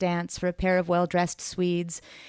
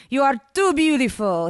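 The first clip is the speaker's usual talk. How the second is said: ordinary delivery, nothing marked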